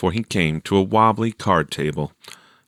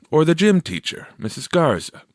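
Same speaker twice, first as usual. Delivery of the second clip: a lightly higher voice for the teacher; teacher voiced; 8 semitones higher than usual. A narrator's reading. Teacher advising Teresa, lightly voiced. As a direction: answer her helpfully.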